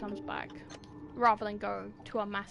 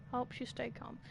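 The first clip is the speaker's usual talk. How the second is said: ordinary delivery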